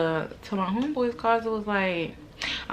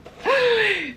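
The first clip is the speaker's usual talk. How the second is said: loud high gasp; sharp gasp; 7 dB louder than usual. Retold or imitated. Punch lands.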